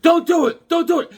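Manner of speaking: put-on high-pitched, urgent kid voice; high pleading friend. Friend Justin begging him off the ice, high and urgent. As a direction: squeal it like a worried little kid. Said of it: "even my friend Justin's like".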